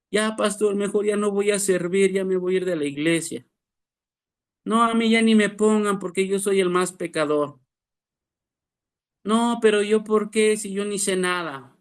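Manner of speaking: high, whiny self-pitying member; discouraged church member. Retold or imitated. Preacher imitates a shrunken believer quitting ministry, high whine. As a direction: plead weakly to quit serving. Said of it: "se han achicado en el ministerio".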